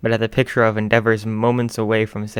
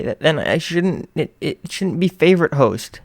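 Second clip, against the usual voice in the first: higher, slow stuttering; nervous stammer; 6 semitones higher, 4 dB louder than usual. Acted. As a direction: stumble nervously.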